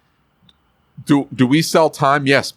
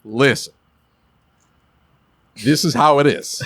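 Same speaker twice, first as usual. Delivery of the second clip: dad voice. Shown as a demonstration. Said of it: "have to put your dad voice".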